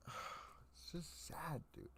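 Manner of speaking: whispered sad. Real, not acted. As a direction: let it die away into a sad whisper. Venting about media disappointment.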